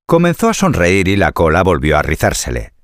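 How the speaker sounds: usual speaking voice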